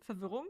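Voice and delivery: Verwirrung pronounced in German; German word. Shown as a demonstration. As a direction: switch into German for Verwirrung, fer-VIR-rung. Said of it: "in German".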